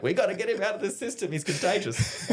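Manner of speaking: high, rushed alarm; mock panic. Army brass wanting the quitter gone, mock panic. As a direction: imitate panicked brass on the edge of laughing.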